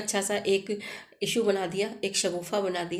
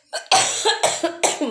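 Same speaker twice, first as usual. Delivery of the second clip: sharp, loud cough that breaks the telling; real cough; 9 semitones higher, 8 dB louder than usual. Real, not acted. Storyteller interrupted by own loud cough. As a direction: cough hard, then carry on. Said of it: "थोड़ी खांसी आ रही है".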